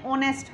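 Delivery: "ऑनेस्ट" with a silent h; "ऑनेस्ट" spoken as o-nest; correct pronunciation. Shown as a demonstration. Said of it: "याद रखना ये होनेस्ट नहीं है".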